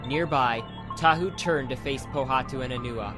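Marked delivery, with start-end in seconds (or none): none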